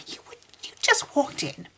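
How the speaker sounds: breathy unvoiced disbelief; whisper. Real, not acted. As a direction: whisper it, appalled.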